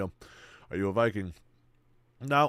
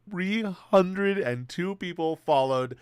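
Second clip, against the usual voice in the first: slow excited count; 6 semitones higher, 5 dB louder, much slower than usual. Real, not acted.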